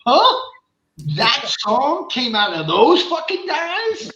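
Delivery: high, loud bewildered huh then slowed question; baffled disbelief. Retold or imitated. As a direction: sound totally confused by the band. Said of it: "you're like".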